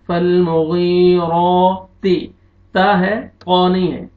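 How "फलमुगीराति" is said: फलमुगीराति chanted slowly with a thick, full ra; heavy raa. Shown as a demonstration. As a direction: make the ra of फलमुगीराति heavy and long. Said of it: "मोटा करके पढ़ना है". (फलमुगीराति में रा मोटा करके)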